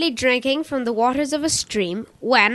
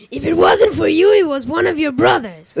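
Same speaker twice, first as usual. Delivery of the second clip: impatient wolf; 3 semitones higher, 6 dB louder than usual. A narrator's reading. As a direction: snap it out, losing patience. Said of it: "more and more impatient".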